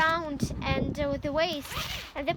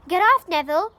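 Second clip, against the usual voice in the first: much louder sudden command; Harry telling Neville to get off; sharp hissed order; 10 dB louder than usual. A narrator's reading. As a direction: snap it at him to move.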